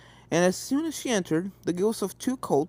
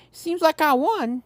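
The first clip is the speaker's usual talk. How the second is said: higher, slow smug gloat; pleased filly; 9 semitones higher, 4 dB louder, slower than usual. A narrator's reading. Apple Bloom thinking she won hide and seek, pleased. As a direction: savour the win.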